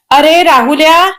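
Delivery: scolding yell. A narrator's reading. Mother summoning her son, yelling. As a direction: call him sharply to attention. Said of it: "त्या राहुलवर ओरडून म्हणाल्या".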